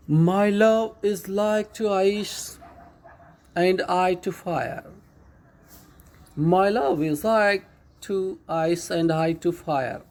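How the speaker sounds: slow, drawn-out reading of a poem line; measured, drawn-out poem reading; slow poem recitation. Shown as a demonstration. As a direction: recite each word slowly to the class. Teacher recites poem line slowly.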